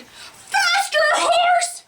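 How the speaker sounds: very high excited cry; shouting. Acted. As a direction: urge the horse on at a squeal. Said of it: "and Mister Pig shouted".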